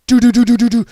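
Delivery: loud rattling machine-gun noise; imitated gunfire. Retelling Storm being gunned down, imitated gunfire. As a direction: fire off a quick even stutter, loudly.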